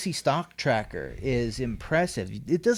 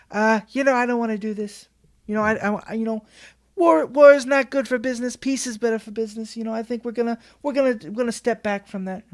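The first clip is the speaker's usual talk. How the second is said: higher, hammed-up Trump with a heavy Italian flavour; Trump impression; 8 semitones higher, 4 dB louder, faster than usual. Trump refusing the aide's war orders, brash mock impression. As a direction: play Trump brushing off the aide, pitched up and brash. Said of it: "a lot of Italian in that, that Trump voice".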